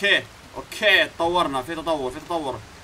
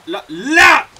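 much higher and louder "لا"; loud cry; 10 semitones higher, 12 dB louder, much slower than usual. Real, not acted. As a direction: yell as he falls. Final fall cry.

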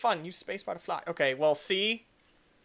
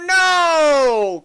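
loud, high, drawn-out no; long anguished cry; dismayed yell; 7 semitones higher, 13 dB louder than usual. Real, not acted. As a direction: wail as it all goes wrong. Crashes while singing.

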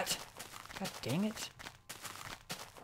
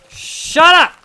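much higher, louder snap; angry outburst; furious shout; 10 semitones higher, 13 dB louder than usual. Real, not acted. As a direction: yell it at the screen. Yelling at game mob.

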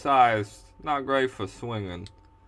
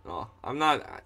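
ordinary talk

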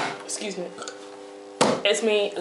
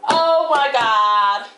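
put-on sassy, indignant girl; 5 semitones higher, 6 dB louder, much slower than usual. Reacting to being dissed by the chicken thief, sassy disbelief. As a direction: drag it out in sassy outrage.

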